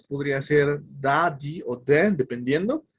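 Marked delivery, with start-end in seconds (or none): 0.8-2.1 "da", "di", "de" said one after another, slowly; three sounds of the. Shown as a demonstration. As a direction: say "da", "di", "de" slowly and clearly. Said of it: "este tiene tres sonidos". (pronunciación lenta de "da", "di" y "de")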